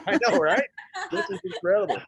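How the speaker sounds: high, loud laugh through the words; delighted laughing reply. Real, not acted. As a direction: laugh out the agreement. Laughing along at the movie idea.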